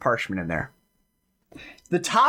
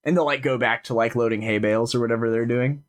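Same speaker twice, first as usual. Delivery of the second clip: ordinary talk, nothing marked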